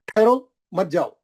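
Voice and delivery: slow, ठहरो set apart from मत जाओ; pause after ठहरो. Shown as a demonstration. Teacher repeating the correct reading, pause after ठहरो. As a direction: halt after ठहरो, then मत जाओ together. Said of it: "यहाँ ठहरो बोल के नहीं रुक".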